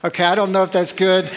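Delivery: usual speaking voice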